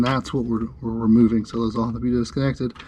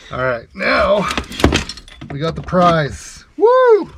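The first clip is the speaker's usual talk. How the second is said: triumphant; 8 semitones higher, 4 dB louder, slower than usual. Real, not acted. Triumphant after freeing the linkage.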